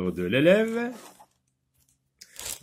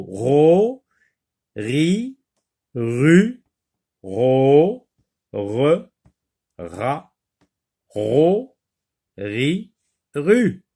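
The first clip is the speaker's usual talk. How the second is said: each syllable on its own with a pause after it; slow drill, one rising syllable at a time; syllable-by-syllable reading; 3 semitones higher, 6 dB louder, much slower than usual. Shown as a demonstration. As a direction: read each syllable separately, like a teacher leading repetition. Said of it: "lis les syllabes".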